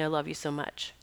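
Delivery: hushed, tender and intimate; soft tender voice. Pretend. Bird thanking its healer, softly and tenderly. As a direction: say it gently, barely above a hush. Bird's thanks heard in her head.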